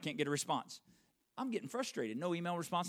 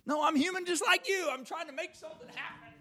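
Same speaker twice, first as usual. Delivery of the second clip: pitched way up, pleading to the congregation; insistent high protest; 6 dB louder than usual. Real, not acted. Pastor telling the church he is human, high and insistent. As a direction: push it up high and insist you're only human.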